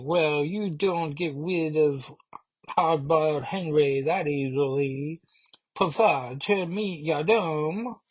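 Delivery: high, slow wiseguy cartoon duck; tough little duck. The hatched duck brags to the team, high cartoon tough guy. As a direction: swagger like a tiny gangster duck. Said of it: "And he's like".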